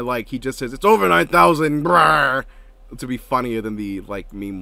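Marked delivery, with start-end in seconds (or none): 0.7-2.4 annoyed. Retold or imitated. Mimicking the funnier dub version, loud annoyed cry. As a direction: yell it with exasperation and a growled tail. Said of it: "he's, like, annoyed".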